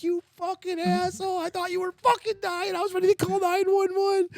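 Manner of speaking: wife scolding her prankster husband; furious wife. Retold or imitated. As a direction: let him have it, shaken. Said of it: "She's like".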